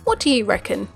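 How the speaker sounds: ordinary talk, nothing marked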